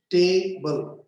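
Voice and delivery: "Table" broken as ta-ble; split in two syllables. Shown as a demonstration. Teacher counting syllables for a table, broken in two. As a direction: say "Table" as two separate beats. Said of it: "two syllable".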